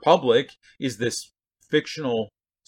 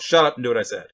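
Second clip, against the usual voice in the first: parent's scolding; 6 dB louder, faster than usual. Acted. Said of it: "parents in particular do this with kids".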